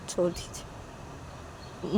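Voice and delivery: slow, breathy whisper of resignation; whispered plea. Acted. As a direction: let the words fade into a whisper. Riya repeats, giving up.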